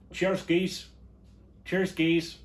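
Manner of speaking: high, loud mock-Scottish accent; cheery hammed-up Scottish voice. Shown as a demonstration. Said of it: "That's my Scottish accent".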